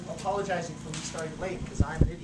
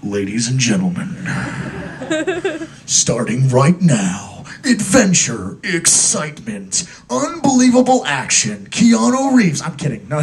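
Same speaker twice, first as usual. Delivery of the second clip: movie guy voice; 13 dB louder, much slower than usual. Acted. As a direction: boom it out like a movie trailer. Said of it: "I'm gonna do the movie guy voice".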